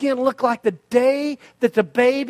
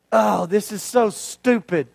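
fed-up groan; 2 semitones lower than usual. Acted. Naaman complains while dipping.

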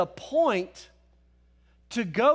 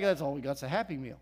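low, quick, quieter grumble; mock glum reply; 9 semitones lower, 6 dB quieter, faster than usual. Disciples short of food, low mock-glum mumble. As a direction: drop it low and fast, underplayed.

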